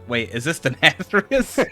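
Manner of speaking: high, loud, drawn-out disbelief; incredulous question breaking toward laughter; astonished double-take. Retold or imitated. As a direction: retell your shocked realization, slowly and loudly. Retelling own astonished reaction.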